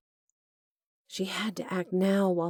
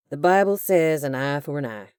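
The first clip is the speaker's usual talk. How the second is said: casual lilt; 8 dB louder than usual. A narrator's reading. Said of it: "a casual lilt in his voice".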